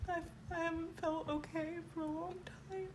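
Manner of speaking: choked-up high confession; weepy and high-pitched. Real, not acted. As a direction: let the tears push the pitch up. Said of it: "All weepy and high-pitched".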